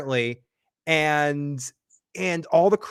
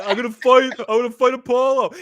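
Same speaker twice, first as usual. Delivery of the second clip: higher, louder, fast hype; pumped-up shout; 8 semitones higher, 7 dB louder than usual. Retold or imitated. Kenny hyping himself to fight Apollo, pumped shout. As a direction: fire yourself up like Rocky.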